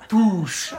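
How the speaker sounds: high mock impact sound; impact noise. Describing the hit on the chin, mock impact noise. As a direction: spit it out like a hit landing.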